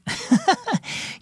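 put-on high, light girlish giggle; a quick amused giggle; girl's laugh. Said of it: "Zoe laughed".

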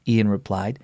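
ordinary delivery

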